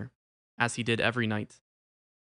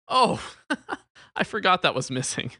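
high, loud "oh" breaking into a chuckle; lifted, chuckling realisation; laughing; 6 semitones higher, 4 dB louder than usual. A narrator's reading. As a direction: laugh it off, amused at yourself. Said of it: "the friar laughed".

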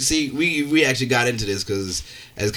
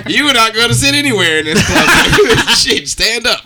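laughing; 8 semitones higher, 7 dB louder than usual. Real, not acted. Laughing at the friend's bar story.

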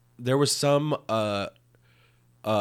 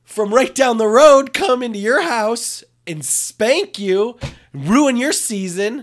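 loud, high exasperated rant; frustrated rant; 12 semitones higher, 6 dB louder than usual. Real, not acted. Friend ranting about the local team, loud and exasperated. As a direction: vent about the team blowing it.

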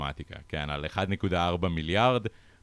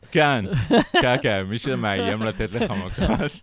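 laughing; 6 semitones higher, 6 dB louder than usual. Real, not acted. Laughing at the thug comment.

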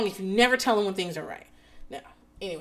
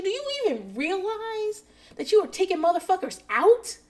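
gushing compliment; 8 semitones higher than usual. Retold or imitated. Parent to child about his skin, gushing compliments. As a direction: hype him up in amazed questions. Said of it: "like, complimenting him and telling him".

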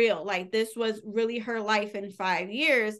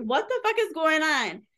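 higher-pitched mock bewilderment; retold disbelief, pitched up; bewildered; 6 semitones higher than usual. Heroine baffled by a five-year jump, bewildered. Character wakes in unknown future.

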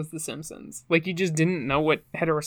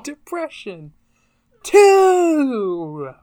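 hype announcer; 10 semitones higher, 10 dB louder, much slower than usual. Pretend. Echoing the stage announcement.

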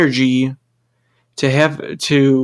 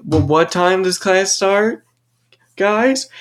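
slow, higher pointed teacher question; mocking teacher; 5 semitones higher, slower than usual. Instructor quizzing the class about start time, slow and pointed. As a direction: needle the latecomer like a smug instructor. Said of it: "he kept on mentioning".

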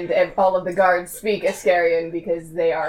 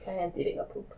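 quiet; 13 dB quieter than usual. Acted. Repeats the line much louder after.

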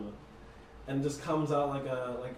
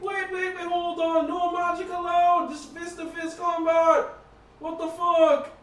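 shouting referee; 10 dB louder than usual. Acted. GM voices NPC stopping fight.

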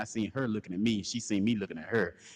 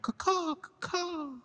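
high mock crow caws; bird caw imitation; slower than usual. Storyteller imitating crows by the lake, high caws.

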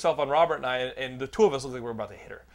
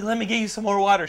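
waitress offer; 6 semitones higher, 6 dB louder than usual. Retold or imitated. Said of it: "She's like".

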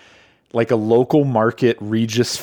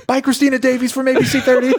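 much higher, fast sing-song farewell; mocking higher goodbye; mock cheery goodbye; 11 semitones higher, faster than usual.

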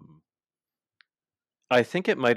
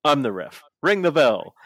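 louder, higher bossy call; mock referee call; 5 semitones higher, 8 dB louder than usual. The ref stepping in, calling for the bell, louder and higher. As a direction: announce it like a smug referee.

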